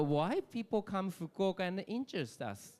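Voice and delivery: usual speaking voice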